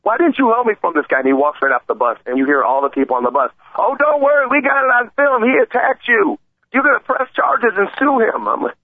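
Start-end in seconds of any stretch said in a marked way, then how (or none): 0.0-1.2 higher indignant mock yell. Old man yelling at the bus driver, mildly raised. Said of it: "he yelled at the bus driver". 3.8-8.5 much higher, eager bystander voice. Retold or imitated. Host imitating bus riders telling the old man to sue, high eager.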